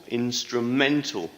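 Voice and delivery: Instrumental repeated slowly, stress on -men-; stress on third syllable. Shown as a demonstration. Teacher repeating Instrumental for students, stress on -men-. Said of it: "Stress is on the third syllable".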